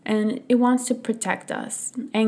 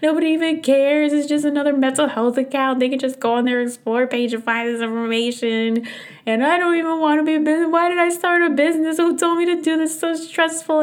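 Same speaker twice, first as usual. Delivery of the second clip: high, loud, whiny self-pity wail; reenacting a crying meltdown in the car; pity party whine; 8 semitones higher, 5 dB louder than usual. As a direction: wail it like a tantrum of despair. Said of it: "just had a full-blown pity party, just crying".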